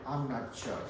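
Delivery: soft, trailing-off doubtful mumble; timid mumble. Retold or imitated. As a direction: mumble it like an unsure kid. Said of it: "some of you were saying".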